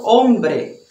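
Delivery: "hombre" with no h sound, starting on the o; silent h. Shown as a demonstration. Teacher showing the silent h in Spanish. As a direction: drop the h and say "hombre" from the o. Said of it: "Não tem som na letra H".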